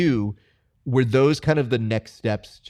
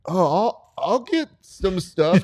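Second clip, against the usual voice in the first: higher, slow, airy mock voice; mocking the guy; 9 semitones higher, 4 dB louder, much slower than usual. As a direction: ham up a clueless guy. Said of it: "type of guy who would have been like".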